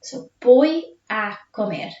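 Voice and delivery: slow, careful Spanish "voy a comer"; model pronunciation. Shown as a demonstration. Teacher modelling "voy a comer" for the class to echo. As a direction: say "voy a comer" slowly for pupils to repeat. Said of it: "repeat after me".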